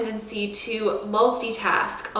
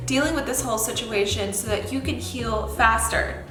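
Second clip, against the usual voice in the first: ordinary talk, nothing marked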